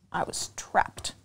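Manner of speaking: soft, breathy hush of dread; a scared storyteller's whisper. Acted. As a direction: drop to a hushed whisper, trapped. Trapped in mud, dramatic hush.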